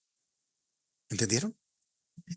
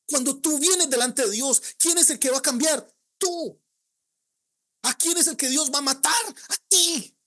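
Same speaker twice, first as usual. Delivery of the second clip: preaching at full force; 9 semitones higher than usual. Real, not acted. Pastor urging the congregation to change, fervent full-force preaching. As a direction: drive it home to the congregation.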